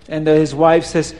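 ordinary delivery, nothing marked

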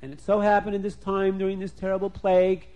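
ordinary delivery

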